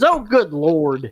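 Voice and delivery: loud, drawn-out retold exclamation; imitating an astonished elder; mock dismay. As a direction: exclaim slowly in exasperated disbelief. Principal's retold shock at his age.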